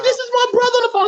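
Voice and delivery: high, fast, overjoyed cry; excited crying. Retold or imitated. Sister telling mom her brother is on the line, overjoyed. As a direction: blurt it out high and fast, near tears. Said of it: "She was crying".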